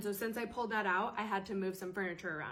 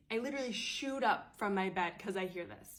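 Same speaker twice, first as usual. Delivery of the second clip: usual speaking voice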